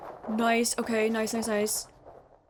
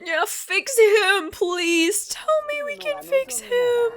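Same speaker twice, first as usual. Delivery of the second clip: tearful pleading; 10 semitones higher, 5 dB louder than usual. Real, not acted.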